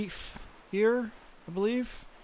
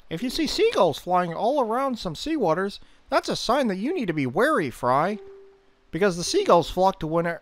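high, eager fish-man warning; chatty fish voice, raised pitch; Fishman voice; 5 semitones higher than usual. Acted. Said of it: "the Fishman voice".

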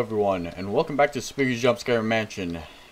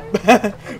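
loud startled laugh; 11 semitones higher, 10 dB louder than usual. Real, not acted. Startled by a jump scare.

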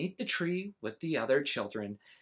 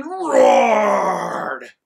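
loud, slow growled roar; roaring dragon; 4 semitones higher, 12 dB louder than usual. A narrator's reading. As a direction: let the words roar like a dragon. Dragon roars in story.

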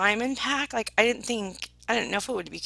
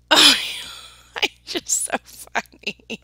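laughing; 4 semitones higher, 10 dB louder, much slower than usual. Real, not acted. Laughing at own joke.

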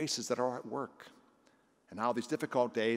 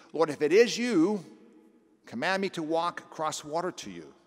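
much louder, higher plea; Peter calling out; 6 semitones higher, 8 dB louder than usual. Acted. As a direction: shout across the water to Jesus. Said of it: "So Peter says to Jesus".

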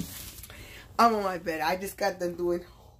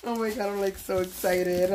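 normal talking voice

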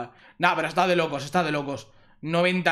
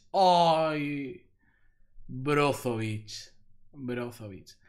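low, slow letdown fading softer; deflated disappointment; 6 semitones lower, 5 dB quieter, much slower than usual. Real, not acted. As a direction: sink into quiet disappointment. Let down by the player drawn. (decepcionado, apagándose)

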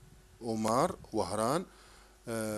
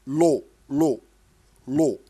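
each syllable called out much louder, one by one; loud drilled syllables; 10 dB louder, slower than usual. Shown as a demonstration. Teacher drilling a child on syllables, loud and separate. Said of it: "لاحظ هنا كيف ننطق".

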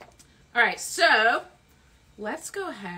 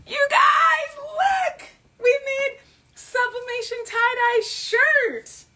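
high joyful shouting; excited shout; 14 semitones higher, 8 dB louder, slower than usual. Real, not acted. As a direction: shout it out with delight. Shouting about the finished shirt.

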